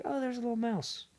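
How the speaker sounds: high, soft mock coo. Woman spotting a mouse in a park, cooing. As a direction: coo softly at a tiny creature.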